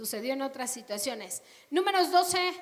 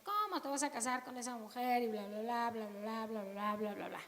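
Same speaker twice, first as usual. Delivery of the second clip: softer gossiping murmur, fading to bla bla; hushed gossip; 3 semitones lower, 9 dB quieter than usual. Acted. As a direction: gossip under your breath. Whispered gossip then bla bla.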